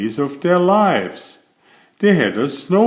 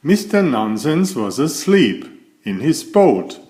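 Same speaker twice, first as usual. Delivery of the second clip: ordinary delivery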